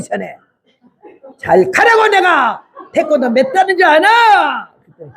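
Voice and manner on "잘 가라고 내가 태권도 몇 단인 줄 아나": high, slow, shouted warning; fierce threat. Retold or imitated. Woman warning the driver she knows taekwondo, slow high shout. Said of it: "얼마나 내가 세게 그랬더니".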